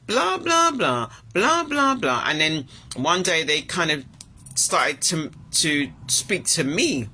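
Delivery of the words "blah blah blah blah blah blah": high, whiny mocking voice, much higher; mocking a bossy coworker. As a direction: sneer it out like a nagging know-it-all. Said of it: "and saying".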